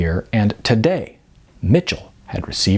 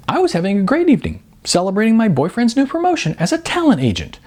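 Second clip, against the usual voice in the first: Sarah, indignant and spiralling; 5 semitones higher than usual. Acted. Girlfriend being dumped at dinner, higher sarcastic rant. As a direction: pile it on, each item climbing.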